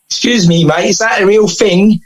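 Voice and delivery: best attempt at a London gangster-film actor's accent. Film actor asking about the trophy, London accent. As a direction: put on a London accent, lifting the pitch. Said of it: "this is my best London accent".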